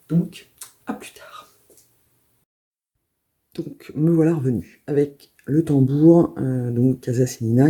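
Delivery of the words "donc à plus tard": whispering. Real, not acted. Signing off the video.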